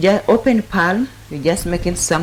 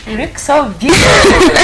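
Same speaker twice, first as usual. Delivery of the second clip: much higher and louder, gushing; excited compliment; 8 semitones higher, 6 dB louder than usual. Real, not acted. Host compliments Sheila on her looks, gushing high and loud.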